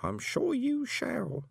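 high, slow, sweet voice with a smile; smiling sweetly. A narrator's reading. Old woman sweetly reassuring shy Moist. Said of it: "smiling sweetly".

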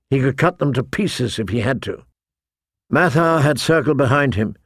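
ordinary delivery

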